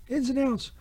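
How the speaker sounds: ordinary talk, nothing marked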